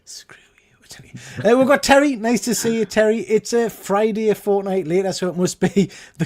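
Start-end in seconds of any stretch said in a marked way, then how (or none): 0.1-1.5 whisper. Pretend. Mock grumble after being teased.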